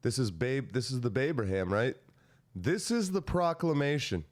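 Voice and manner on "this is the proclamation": high, slow, grandly declaimed; proclamation reading. Acted. Reading Lincoln's proclamation to co-hosts, declaiming. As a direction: announce it like an official decree.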